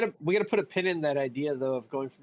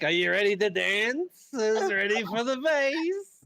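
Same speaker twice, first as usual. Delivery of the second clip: slow, hammed-up Yiddish-tinged hype; 4 semitones higher, slower than usual.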